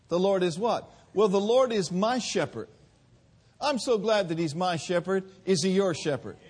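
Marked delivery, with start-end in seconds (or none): none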